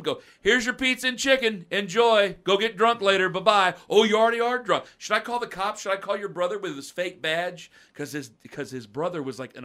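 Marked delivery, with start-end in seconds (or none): 0.4-7.7 higher, louder sarcastic taunting; snarky send-off. Retold or imitated. Pizza clerk taunting a drunk regular at the counter. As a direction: rub it in with mock cheer.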